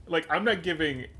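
ordinary talk, nothing marked

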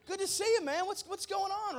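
high, loud, gushing welcome; hammed-up high-pitched excitement; excited greeting. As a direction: greet an old friend with over-the-top delight. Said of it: "David is excited, the king".

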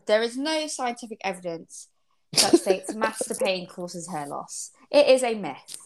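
ordinary delivery

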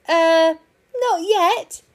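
high, loud halting reply; stall, then answer in a high pitch; hesitant answer. A narrator's reading. Park keeper answering the hedgehog's question, hesitant.

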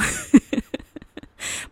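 high unvoiced giggle; a quick airy laugh at herself; sudden whispery cackle; breathy laugh. Real, not acted. Laughs after the intro.

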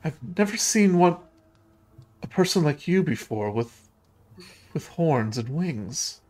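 lightly voiced girl in wonder; a hint of a curious child, slowing at the end; curious ghost girl. Voices Rose marvelling at stranger.

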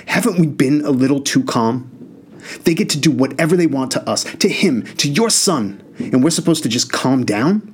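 anger building against the parents. Acted. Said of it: "Spoonie's voice got significantly less calm".

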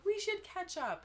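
high, overly sweet teacher voice; fake-friendly old teacher; gushing teacher. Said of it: "He was like".

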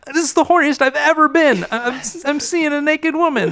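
high excited voice; giddy Frank. Acted. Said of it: "Frank is like".

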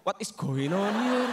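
slow, drawn-out English in a put-on voice; possessed foreigner. Westerner brought to Java suddenly possessed, slow put-on voice. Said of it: "Pas kesurupan tiba-tiba ngomong".

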